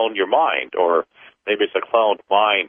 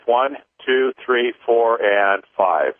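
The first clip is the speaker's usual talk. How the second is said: paced counting; 4 semitones higher, much slower than usual. Acted. Leads a clearing count.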